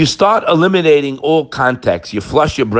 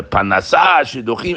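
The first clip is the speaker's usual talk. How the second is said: normal talking voice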